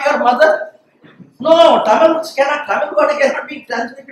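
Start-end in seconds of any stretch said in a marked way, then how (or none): none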